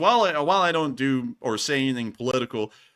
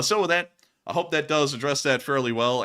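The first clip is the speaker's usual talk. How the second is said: ordinary talk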